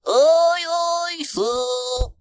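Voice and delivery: very high character voice, loud at the start; high little sailor's reply; monkey first mate. A narrator's reading. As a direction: answer the captain in a high-pitched voice. Monkey mate answers captain's order.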